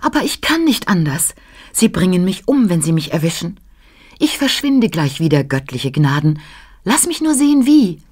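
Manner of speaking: high, frantic little flea voice; breathless flea. A narrator's reading. Hunted flea explains himself to the child, breathless and high. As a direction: plead urgently in a high, tiny voice. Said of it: "flüsterte der atemlose Floh".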